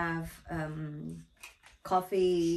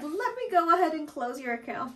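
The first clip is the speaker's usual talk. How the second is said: eager agent; 10 semitones higher, 3 dB louder than usual. Retold or imitated. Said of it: "And I was like".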